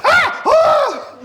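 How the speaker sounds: shrill panicked screams, very high and loud; wild screaming. Acted. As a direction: scream wildly like a man on fire. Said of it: "He's just screaming wildly".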